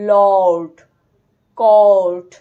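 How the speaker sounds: teacher stretching the aw; long aw demo. Shown as a demonstration. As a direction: hold the aw in লর্ড and কর্ট. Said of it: "এটা কিন্তু long আ".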